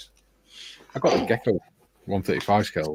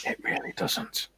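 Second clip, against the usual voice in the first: whispered; 8 dB quieter, slower than usual. Real, not acted. Whispered banter.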